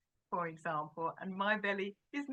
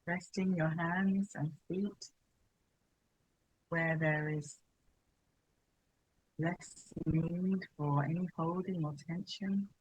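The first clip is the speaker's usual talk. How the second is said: unhurried, even, spacious delivery; guided meditation; 6 dB louder than usual. Real, not acted. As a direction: guide them gently into settling, phrase by phrase. Leads a settling meditation.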